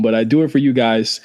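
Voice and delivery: ordinary talk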